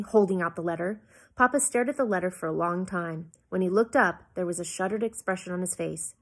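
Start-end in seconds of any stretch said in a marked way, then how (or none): none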